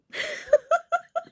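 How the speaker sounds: high, loud laugh close to crying; tearful laugh. Real, not acted. Speaker ending a painful story, real tearful laughter. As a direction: burst out laughing through tears. Emotional release after telling the story.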